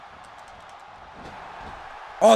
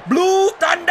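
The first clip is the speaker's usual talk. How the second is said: shouted move name; 10 semitones higher, 5 dB louder, slower than usual. Real, not acted. Gamer yelling his finisher as it lands, loud and high.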